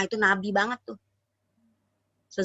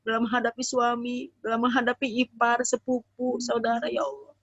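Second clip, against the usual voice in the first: heartfelt prayer; 2 semitones higher than usual. Acted. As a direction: pray for the whole room, humbly. Leads a prayer.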